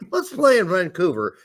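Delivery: ordinary talk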